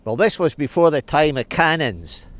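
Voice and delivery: plain reading voice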